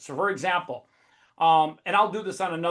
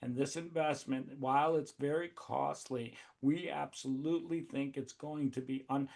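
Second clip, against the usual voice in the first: much softer and lower, flat and bored; mock a timid investor pitch with no energy; mumbling a limp, unexcited pitch; dull, lifeless pitch; 4 semitones lower, 11 dB quieter than usual. Shown as a demonstration. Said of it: "talk like this and say that".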